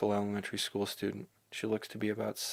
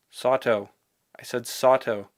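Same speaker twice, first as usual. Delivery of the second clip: calling out; 2 semitones higher, 8 dB louder, slower than usual. Acted. Misaki calling his name.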